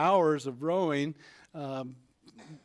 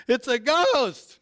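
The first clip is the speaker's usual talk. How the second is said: higher, louder, slow terrified cry; frightened shout; 9 semitones higher, 6 dB louder, slower than usual. Acted. Disciples in the boat shrieking at the approaching figure. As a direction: scream it like you've seen a ghost.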